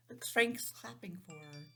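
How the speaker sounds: usual speaking voice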